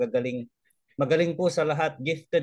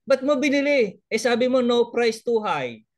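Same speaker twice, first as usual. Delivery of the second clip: higher, louder incredulous complaint; exasperated husband; 9 semitones higher, 5 dB louder than usual. Retold or imitated. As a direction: scold her as the baffled husband. Husband upset at wife's purchase.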